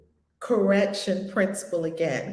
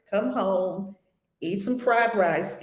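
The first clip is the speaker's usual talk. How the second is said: ordinary delivery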